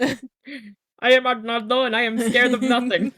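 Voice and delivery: loud, high boastful character voice; bold hero voice. Pretend. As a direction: declare it like a cartoon hero. Movie quote, loud.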